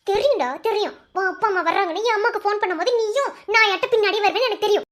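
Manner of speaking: high-pitched, heated accusation; angry accusing. Acted. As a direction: scold a partner you don't believe. Lovers' quarrel, she accuses him.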